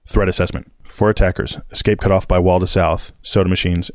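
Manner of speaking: usual speaking voice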